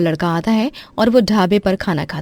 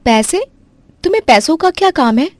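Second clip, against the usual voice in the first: surprised sister; 9 semitones higher, 4 dB louder than usual. Acted.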